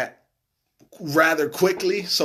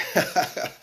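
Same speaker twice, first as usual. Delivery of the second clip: brief, breathy laugh; short chuckle; 4 semitones higher than usual. Real, not acted. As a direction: let out a quick amused laugh. Amused by a viewer's comment.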